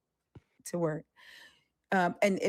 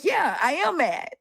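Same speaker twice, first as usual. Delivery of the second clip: sassy clap-back to a believer in chat; defiant sass; 10 semitones higher, 4 dB louder than usual. Retold or imitated. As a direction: own it and throw it back, higher and louder. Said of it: "I'm like".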